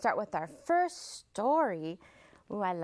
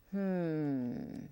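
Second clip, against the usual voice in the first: ordinary delivery, nothing marked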